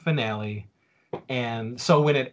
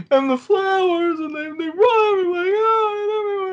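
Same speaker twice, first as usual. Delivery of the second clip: mock sobbing friend; 5 dB louder than usual. Imitating Richard sobbing over the finale, high mock wail. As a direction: wail it like a heartbroken kid. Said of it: "I remember him being like".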